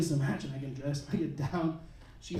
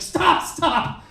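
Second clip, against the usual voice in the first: loud, high "stop, stop!"; mock yell; 7 semitones higher, 5 dB louder than usual. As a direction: cry out to make her stop. Pushing her head back, calling out.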